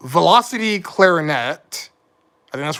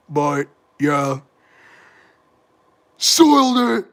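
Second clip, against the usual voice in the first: Patrick impression; slower than usual. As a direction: play a dopey cartoon starfish hosting a video, pitching up on the last cry. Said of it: "That's my Patrick impression".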